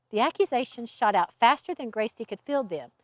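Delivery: usual speaking voice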